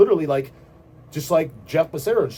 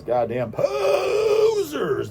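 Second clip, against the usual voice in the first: drawn-out mock shout; 13 semitones higher, much slower than usual. Ranting vlogger hurls a long, high mock shout at posers. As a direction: stretch it into a high, slow mocking shout. Insulting rival band.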